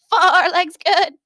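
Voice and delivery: high, slow sheep bleat stretching every word. Acted. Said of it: "they would start bleating".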